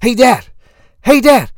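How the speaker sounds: put-on high, loud little-kid voice; impression of a pestering young son; four-year-old son. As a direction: call out like an eager small boy. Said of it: "do my best impression of my son".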